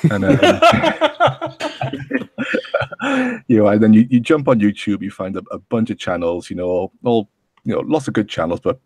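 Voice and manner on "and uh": high giggle. Real, not acted. Laughs at own joke.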